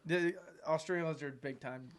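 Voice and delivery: ordinary delivery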